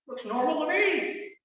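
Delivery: skeptical retort. Retold or imitated.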